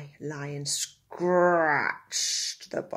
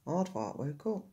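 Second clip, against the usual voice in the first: usual speaking voice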